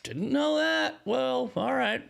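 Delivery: high, put-on "oh well" voice; mock resignation in a high voice; feigned ignorance. Acting out feigned surprised acquiescence.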